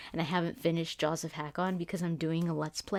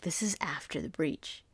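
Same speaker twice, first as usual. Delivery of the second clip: whisper; 7 dB quieter than usual. Retold or imitated. Whispered realization.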